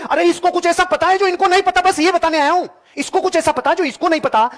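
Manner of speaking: high-pitched, impassioned insistence; emphatic insistence. Real, not acted. Seminar speaker hammering his key point to the audience, emphatic insistence.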